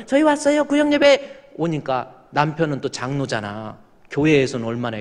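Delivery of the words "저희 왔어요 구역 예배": high, chirpy announcement; cheerful arrival. Retold or imitated. Visiting women announcing themselves, high and cheerful. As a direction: sing out that we're here. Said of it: "하면서".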